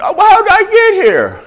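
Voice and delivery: very high put-on wail; high-pitched imitation of self-pity; mock lament. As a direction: wail it as an acted complaint. Voices a regretful person in a cell.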